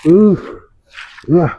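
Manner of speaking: moaning from burning heat; pained cries. Acted. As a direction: gasp and moan as if scorched. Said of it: "Keenakan apa kepanasan".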